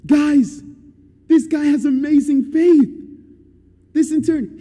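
loud, high-pitched call to the crowd; imitating an amazed Jesus, loud and high; amazed announcement. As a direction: proclaim it excitedly to everyone. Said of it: "you know what Jesus does".

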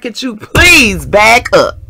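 slow, loud retold begging for room; exasperated plea. Woman to the man who won't leave, exasperated plea. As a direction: drag out "please", loud and insistent.

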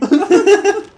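high squealing laugh burst. Real, not acted. Laughing at the sound.

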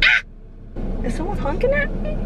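high, fast startled question; startled by a car horn; surprised. Real, not acted. Driving, surprised by a honk, high startled question.

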